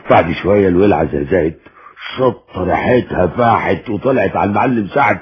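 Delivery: usual speaking voice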